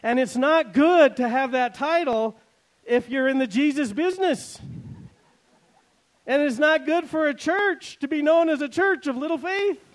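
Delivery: full-force pulpit exhortation; preaching at full force. Acted. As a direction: drive the warning home to the church. Preaching climax.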